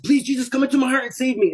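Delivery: rushed, very high-pitched reenacted prayer; desperate plea. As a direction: plead for rescue in a panicked rush. Retells desperate prayer to be saved.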